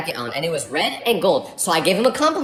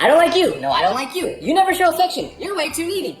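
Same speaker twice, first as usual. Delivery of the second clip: mock squabbling lovers, higher; bickering couple; 7 semitones higher than usual. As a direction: act out a petty couple fight. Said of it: "they were like".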